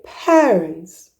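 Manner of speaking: "parents" said very slowly with an air vowel; slow model word. Shown as a demonstration. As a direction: draw out "parents" gently. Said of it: "Then go saying parents, say".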